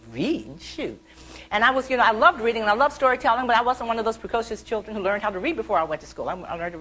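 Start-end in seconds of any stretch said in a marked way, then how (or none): 0.1-1.0 quiet, disgruntled mock grumble; imitated childish huff, under the breath; grumbling child. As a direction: mutter it like a put-off kid. Child grumbles after brush-off.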